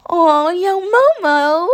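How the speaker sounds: very high, loud and slow squeal. A narrator's reading. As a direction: stretch it out in delight. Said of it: "Mina squealed".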